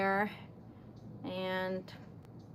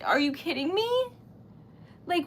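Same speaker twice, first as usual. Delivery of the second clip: ordinary talk, nothing marked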